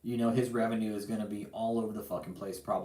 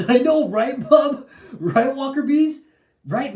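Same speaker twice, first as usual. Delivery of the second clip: high, loud words through real laughter; laughing; 13 semitones higher, 12 dB louder, slower than usual. Real, not acted. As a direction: laugh it out while agreeing with friends. Laughing at David with friends.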